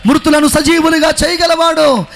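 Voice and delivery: slow, loud and emphatic shout; loud booming proclamation. Retold or imitated. Doctor declares to the healed man, loud slow shout. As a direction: proclaim it slowly at full volume. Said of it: "చాలా బిగ్గరగా ఆయన చెప్తున్నాడు".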